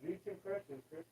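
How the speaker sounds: calling from across the room; distant, off-mic call; far off. Real, not acted. Speaking from away from the mic.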